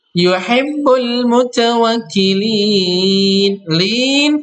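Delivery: mutawakkilin chanted very slowly with a drawn-out last vowel; long final vowel. Shown as a demonstration. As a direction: stretch the ending on the pause. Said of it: "mad arid li sukun".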